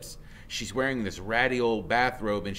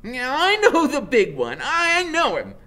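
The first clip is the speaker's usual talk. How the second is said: much higher, louder laughing voice; cackling woman; 9 dB louder than usual. Acted. Woman recognising the big kid, laughing loud. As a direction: crow it with a laugh. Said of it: "the woman is saying".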